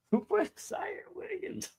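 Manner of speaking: very high, soft, slow mock echo; laughing mimicry. Teasing co-host who forgot his own signing, laughing mimicry. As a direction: squeak it through laughter, imitating the outburst.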